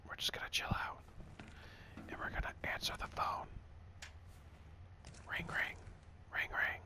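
soft, breath-only whisper; mock ASMR whisper. Streamer fakes an ASMR phone call, whispering. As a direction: play at ASMR, whispering into the mic. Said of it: "to be doing A S M R".

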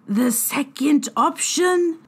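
frightened fairy begging for a way out; sudden eager fairy. Acted. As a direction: ask the second option, suddenly interested. Fairy healer voice.

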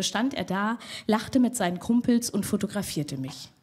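normal talking voice

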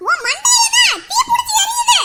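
high, loud shout breaking into laughter; teasing shout through laughter; laughing shout. Acted. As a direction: yell it while cracking up. Daughter mocks mother's burning head.

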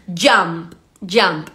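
"jump" low and falling, the p released; low-pitched "jump" with an audible p; sounded final P. Shown as a demonstration. Teacher repeating the word for learners, final P sounded. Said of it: "la P final que suene".

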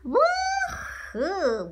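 high whooping cheer; a high, excited whoop. Real, not acted. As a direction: cheer like you just got great news. Cheering about going to New York.

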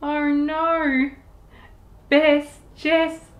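ordinary delivery, nothing marked

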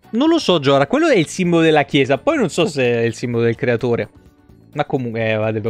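usual speaking voice

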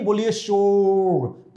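शोर said slowly with a long श; drawn-out sh. Shown as a demonstration. Teacher drilling pupils on the sound. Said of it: "please repeat after me".

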